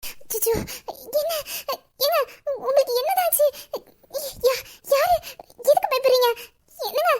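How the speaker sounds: high, tearful worried pleading; anxious worry. Acted. Wife frightened by her crying husband, high anxious pleading. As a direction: fret over him with a crying edge.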